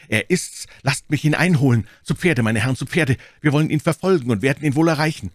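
high, loud, urgent rallying cry; fierce, furious cry. A narrator's reading. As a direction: rage rushing into the chase. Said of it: "rief D'Artagnan blass vor Ingrimm".

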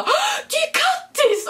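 high, slow delighted shout of a name; excited squeal. Retold or imitated. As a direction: squeal it out like you spotted a friend. Girls recognise her.

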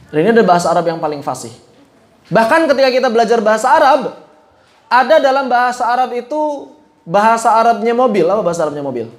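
normal talking voice

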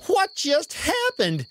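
usual speaking voice

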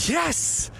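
high-pitched, delighted yes; happy, elated exclamation; delighted cheer. Real, not acted. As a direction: celebrate a flattering answer. Delighted by a flattering age guess.

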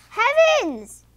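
high, loud exclamation of alarm; startled, high-pitched outburst; shocked cry. A narrator's reading. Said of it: "cries the farmer's wife".

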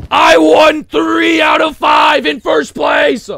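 loud, high, slow victory yell; proud, drawn-out shout; boastful shout. Real, not acted. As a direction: bellow the win, every word stretched. Bragging about game wins.

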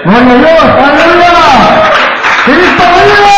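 high-pitched shouted praise and exhortation; real revival preacher's cry, voice pushed high; impassioned preaching. Real, not acted. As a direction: preach it out at full fervour. Climax of testimony, preaching.

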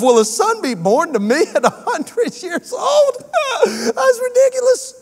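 high, slow, laughing through the words; laughing disbelief. Retold or imitated. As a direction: laugh at the absurd promise. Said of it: "he laughed and said to himself".

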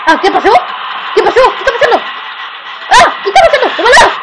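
loud, high-pitched startled cries; panicked shouting. Real, not acted. Young player startled by a hallucination, panicked shouting. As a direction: shout in alarm, voice shooting up high. Sudden scare in the game.

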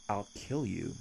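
softly menacing, as if it were simple; soft threat. Acted. Casual death threat to captive listener.

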